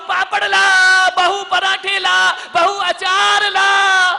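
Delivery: put-on an old man yelling orders at his daughter-in-law; loud, slow, greedy calling out; old man bellowing for food. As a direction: shout it high and drawn out, demanding.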